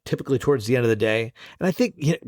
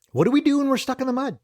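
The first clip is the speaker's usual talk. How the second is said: much higher and fast, playfully dramatic; hammed-up question; 11 semitones higher, faster than usual. Host quizzes listeners about being stuck, mock-dramatic pitch. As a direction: throw the question out with mock urgency.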